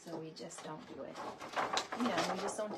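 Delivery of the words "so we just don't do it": ordinary talk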